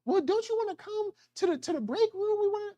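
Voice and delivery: sweet high coworker invitation; high friendly coworker. Retold or imitated. Coworker inviting him to the break room, high and sweet.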